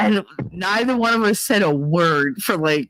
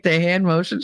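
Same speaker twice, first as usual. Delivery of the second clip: ordinary delivery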